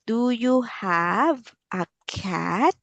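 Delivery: slow, clear, drawn-out question; slow teacher voice. Shown as a demonstration. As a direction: say it slowly for a child. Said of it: "repeated slower".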